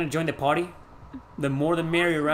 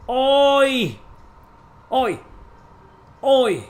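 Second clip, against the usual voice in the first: repeated oi, high and loud; mock oi calls; 9 semitones higher, 7 dB louder, much slower than usual. Streamer chants the Brazilian greeting oi. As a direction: call out oi like a Brazilian. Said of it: "In Brazil, they say".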